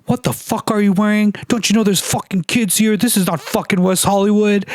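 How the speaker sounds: high-pitched mock tirade of an outraged dad; retold angry yelling. Dad scolding a beachgoer over a thong, mock angry yelling. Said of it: "comes to me and yells at me".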